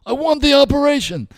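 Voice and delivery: high, loud, eager put-on voice; patient's loud, high joke voice; high-pitched patient. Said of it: "And he goes".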